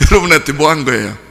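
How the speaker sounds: ordinary talk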